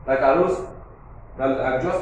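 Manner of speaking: ordinary delivery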